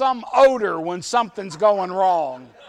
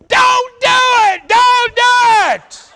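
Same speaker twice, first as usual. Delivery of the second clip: very high, loud, drawn-out yell; old man yelling; 13 semitones higher, 10 dB louder, slower than usual. Retold or imitated. Old man running down the hill yelling at the driver, very high loud yell. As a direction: holler it down the hill.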